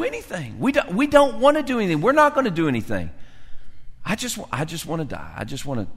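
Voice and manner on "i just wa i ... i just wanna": mock mopey murmur. Voicing the Monday quitter.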